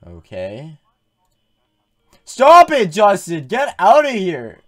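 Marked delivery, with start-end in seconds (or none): 2.2-4.6 frantic yell; shouting. Real, not acted. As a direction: yell them out of the room. Yelling at game character.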